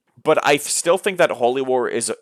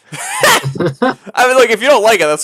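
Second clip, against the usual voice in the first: high, loud, through laughter; laughing reassurance; 12 semitones higher, 8 dB louder than usual. Real, not acted. Host telling guest dislike is allowed, laughing and loud. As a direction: laugh it off loudly, pitched up.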